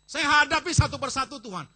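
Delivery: high, loud cry of faith; shouted declaration. Shown as a demonstration. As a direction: shout it out to the Lord. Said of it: "Kasih tahu dia".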